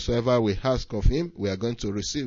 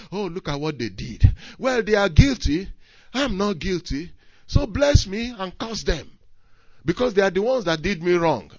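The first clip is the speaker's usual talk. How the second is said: full-force preaching; 9 semitones higher than usual. Acted. Preaching peak again.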